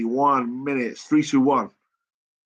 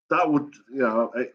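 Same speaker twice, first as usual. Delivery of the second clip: normal talking voice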